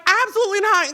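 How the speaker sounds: high, loud retold denial; emphatic mock denial. Retelling Kamala's answer to the host, emphatic mock denial. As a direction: pitch it up, firmly imitating her reassurance. Same reassurance retold again.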